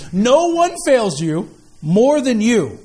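drawn-out proclamation to a congregation; reading out loud. Shown as a demonstration. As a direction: read it out boldly, stretching each word. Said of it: "I'm gonna read it out loud".

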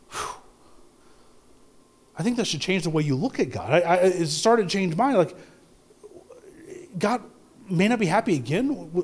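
ordinary talk

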